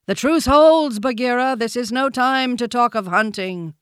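high, loud elephant proclamation; commanding elephant. A narrator's reading. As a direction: declare it loudly to the whole gathering. Elephant calls the herd to order.

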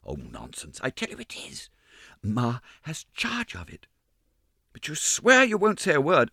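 put-on gossiping ballet girls, half whispering; lightly voiced ballet girls. Ballet girls gossip about the ghost's box, lightly girlish.

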